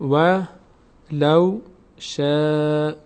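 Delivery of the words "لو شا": لو شا held long and light; slow tajwid recitation. Shown as a demonstration. Teacher models لو شا for students. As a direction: draw out شا lightly. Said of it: "پر خونده نمیشه".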